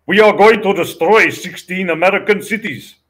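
put-on loud, high, hammy Russian-accented threat; bad Russian accent. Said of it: "with these bad Russian accent, as bad as mine".

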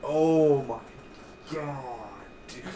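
oh my God, real shock; excited outburst. Real, not acted. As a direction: blurt it out, amazed. Excited recap of shocking scene.

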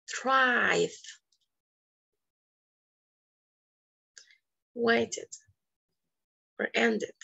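each word said alone, \"Liked\" with crisp t, \"needed\" with id; contrast -ed endings. Shown as a demonstration. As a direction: model the different endings one by one. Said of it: "Listen, it's different". (contrasta cada final -ed)

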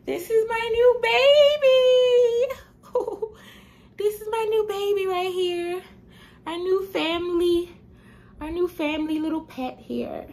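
squeaky high pet voice for the kitten. Real, not acted. Showing the new kitten.

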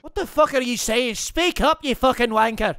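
cocky mock Irish accent, lightly done; a gamer playing brash Irish fighter Conor McGregor; swaggering put-on Irish brogue. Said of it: "My name is fucking Conor McGregor".

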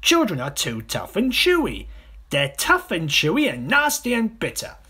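high, loud, protesting croc; disgusted smaller crocodile. Acted. Smaller crocodile arguing children taste bad, high and disgusted. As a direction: complain shrilly as the little croc.

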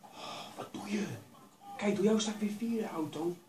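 loud, high-pitched protest; indignant outburst. Real, not acted. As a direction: yell at the driver who wrecked your race. Blames car that knocked him back.

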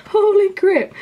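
high, loud amazed cry; real squeal of disbelief; stunned elation. Real, not acted. Confirms second positive test.

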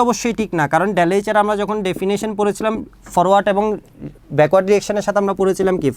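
ordinary delivery

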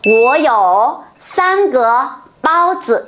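Wo you san ge said very slowly, word by word; slow model sentence. Shown as a demonstration. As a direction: spell out Wo you san ge bao zi for learners. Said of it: "bọn mình sẽ nói làm sao".

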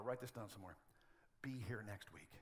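murmured follow-up instruction; same words, now hushed and low; quiet repeat. Shown as a demonstration.

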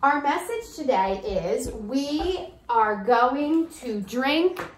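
slow, deliberate dictation pace; slow message. Shown as a demonstration. Teacher reads the daily message to kids, slowly. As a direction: spell it out word by word.

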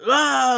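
high, loud villain yell; hammed-up villain shout; bad guy yell. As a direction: let out a short fierce battle cry. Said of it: "like the bad guy yells".

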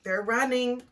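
plain reading voice

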